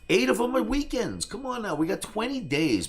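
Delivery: high-pitched, insistent pitch; retold exasperated haggling; pressing argument. Retells haggling challenge to Kramer.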